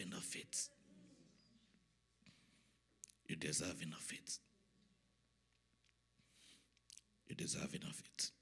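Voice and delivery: soft, reverent prayer with long pauses; hushed prayer. Real, not acted. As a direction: pray quietly, line by line.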